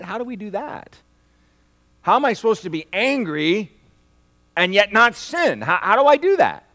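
rising loud pleading questions; loud, high, bewildered asking; exasperated question. Acted. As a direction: throw up your hands, how is this possible. Preacher voicing confused listener.

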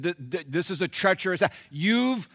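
loud, high angry accusation; heated loud charge; accusing Moses. Acted. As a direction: blame him with rising fury. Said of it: "They said".

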